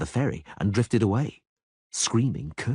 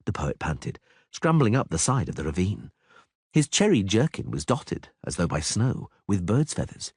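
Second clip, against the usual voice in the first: ordinary delivery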